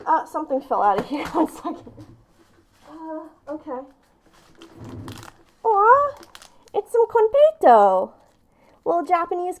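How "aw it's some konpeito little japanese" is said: delighted coo. Real, not acted. Finding candy in gift package.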